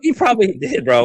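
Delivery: laughing out loud, pitch jumping up. Real, not acted. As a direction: crack up at the joke while talking. Laughing at friend's joke.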